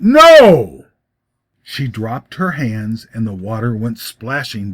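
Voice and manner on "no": high, loud shouted refusal. A narrator's reading. Joe shouting his refusal of the water, full shout. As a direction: throw the no at her with everything. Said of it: "he shouted it".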